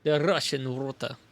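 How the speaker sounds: Russian water with a rolled Russian accent. Shown as a demonstration. Gamer practising a Russian accent from a video, Russian accent. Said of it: "Russian accent".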